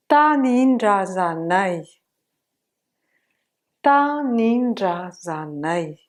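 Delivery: "ta ninja zanai" drawn out slowly, stretched vowels; slow, gliding "ta ninja zanai"; slow reading demo. Shown as a demonstration. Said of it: "on a fait chanter".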